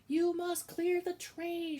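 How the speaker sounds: slow, high, loud shout; shouted order. Acted. Cook shouting the order at Midge, slow loud shout. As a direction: bellow it slowly. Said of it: "shouted Cook".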